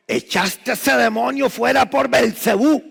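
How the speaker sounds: high, loud, slow scornful accusation; accusing Pharisees. Acted. Pastor voicing Pharisees accusing Jesus, loud mocking. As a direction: voice the Pharisees hurling the charge. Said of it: "aquellos fariseos le dijeron".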